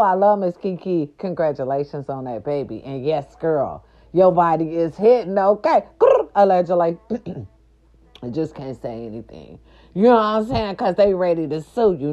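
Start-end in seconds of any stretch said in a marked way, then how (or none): 6.0-6.3 playful high growl. Real, not acted. Signature growl.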